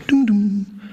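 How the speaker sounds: airport chime. Retold or imitated. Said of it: "You'll hear that woman come on the system".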